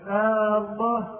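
long, high "آه" before "الله"; long first vowel. Shown as a demonstration. Teacher isolates the faulty word, first vowel stretched high. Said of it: "أما كلمة".

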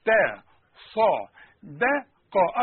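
"تا صا دا قا" slowly, lips shifting per letter; slow letter drill. Shown as a demonstration. Teacher drilling letters with lip shapes, slow. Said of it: "رجع شفايفك على هيئة ابتسامة".

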